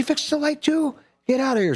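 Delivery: very high, comic astonishment; amazed disbelief. Acted. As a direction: act out a husband who can't believe his luck. Husband amazed at repairs.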